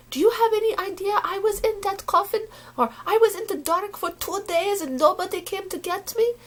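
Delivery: a hint of the hurt grandmother; grandmother's voice. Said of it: "and she'd go".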